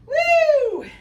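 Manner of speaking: high, loud whoop of encouragement; cheering whoop. Real, not acted. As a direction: whoop to pump up the class. Trainer cheering mid-workout.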